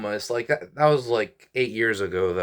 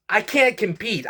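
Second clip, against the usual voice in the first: exasperated, pitched up high; venting that he can't keep up; frustrated outburst; 9 semitones higher than usual. Real, not acted. Frustrated vlogger complaining to viewers, pitched-up exasperation. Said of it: "I'm just frustrated".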